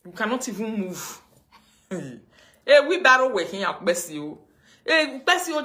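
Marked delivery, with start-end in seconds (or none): 2.6-5.6 mocking call. Mocking the rival on stream.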